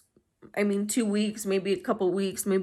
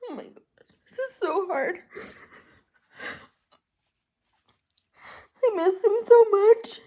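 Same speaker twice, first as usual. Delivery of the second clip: real crying; 13 semitones higher than usual. Real, not acted. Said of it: "I'm gonna try not to cry anymore".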